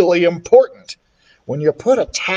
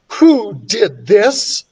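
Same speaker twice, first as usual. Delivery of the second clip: slow, higher teacher's demand; stern demand; 5 semitones higher, much slower than usual. Acted. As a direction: demand it word by word. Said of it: "she demanded".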